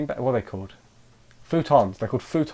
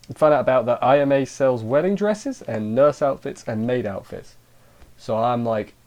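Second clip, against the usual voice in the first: ordinary delivery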